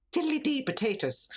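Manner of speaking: high, silly stage-Irish lilt; jokey sing-song Irish; mock Irish accent. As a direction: ham up a cartoonish Irish brogue. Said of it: "that's Saint Patrick's Day".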